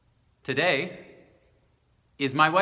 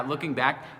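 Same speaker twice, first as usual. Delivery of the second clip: usual speaking voice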